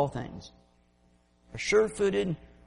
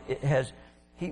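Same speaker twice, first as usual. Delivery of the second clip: ordinary talk, nothing marked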